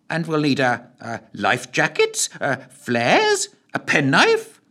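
put-on high fussy old gent, suddenly inspired; old gentleman. A narrator's reading. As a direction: stammer out the plan like a doddery old gent. Voices Lushthorpe.

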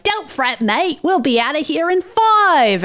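imitated Australian accent; slow, hammed-up Australian accent, lifted in pitch; Pyro. Pyro reassuring the guard, bad Australian accent. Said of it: "Pyro has an Australian accent, which is not very well done".

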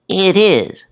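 very high put-on British receptionist; high-pitched British voice. A narrator's reading. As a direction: answer in a lilting British falsetto. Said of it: "in a high-pitched British voice".

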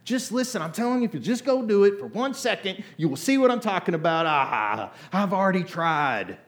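mock a kid who won't listen; whiny kid voice. Parent mimicking a child talking back, whiny and high. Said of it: "Your kids say stuff like that".

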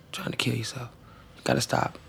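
an urgent whisper from a worried brother; whispered plea. Retold or imitated. Brother begging his younger sister to stop, whispered. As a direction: whisper it so only she hears.

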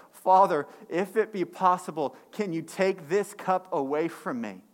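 loud anguished plea to the Father; a hint of Jesus begging in the garden; pleading Jesus. Jesus begging his Father to take the cup, loud plea.

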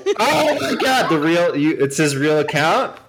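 high squeal with laughter; laughing disbelief. Real, not acted.

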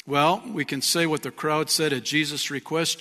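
ordinary talk, nothing marked

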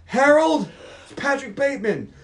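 loud, high, hammed-up panic; over-the-top desperate caller; frantic mock confession. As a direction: blurt it down the phone at high pitch. Acted frantic confession to lawyer.

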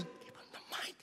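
hushed, voiceless order; urgent whisper. Retold or imitated. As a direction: whisper it so only the preacher hears. [name] repeats it covertly during preaching.

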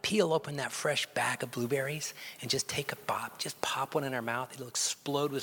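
hushed whisper, barely voiced. Pastor whispers the blueberry image to the congregation, hushed.

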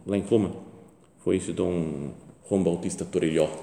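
usual speaking voice

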